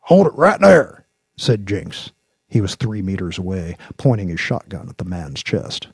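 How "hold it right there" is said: high, loud order to halt; commanding shout. A narrator's reading. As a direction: call out like a lawman stopping a suspect. Lawman halts an armed moonshiner.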